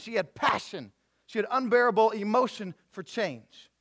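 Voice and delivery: high, loud, driving sermon delivery; impassioned preaching. Real, not acted. Pastor preaching on the woman's faith to his church, impassioned. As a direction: press each phrase home with fervour.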